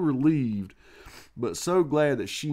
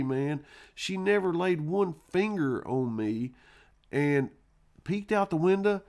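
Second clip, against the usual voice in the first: normal talking voice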